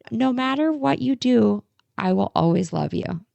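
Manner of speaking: a gentle hint of her little boy; son's words. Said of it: "He goes".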